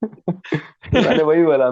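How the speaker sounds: words come out on a laugh. Real, not acted. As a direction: laugh the line out. Laughs while retelling to father. (हँसते हुए बोलना)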